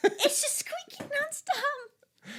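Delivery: high, slow whine; high whiny voice. Real, not acted. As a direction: whine about the squeaky stool. Complaining about squeaking stool.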